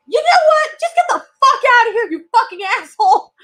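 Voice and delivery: high, loud retort easing down in pitch; fed-up outburst. Retold or imitated. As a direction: re-enact finally losing patience, starting very high. Retold outburst at departing guest.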